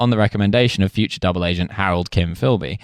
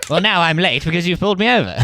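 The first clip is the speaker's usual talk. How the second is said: Burgess to cop; 8 semitones higher than usual. Pretend. Voicing drunk Burgess to police.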